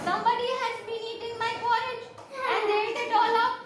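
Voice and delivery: high-pitched small bear cub voice; little high voice for a baby bear. A narrator's reading. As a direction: pitch it up like a tiny storybook cub. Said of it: "little bear said in his little voice".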